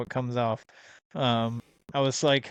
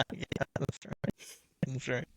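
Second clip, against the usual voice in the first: breathless laughing; 10 dB quieter, slower than usual. Real, not acted. Wheezing after laughter.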